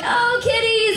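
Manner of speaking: high-pitched kitten coo; mock cooing. Kids finding kittens in a barn, high mock cooing. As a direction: coo it high, like a delighted child.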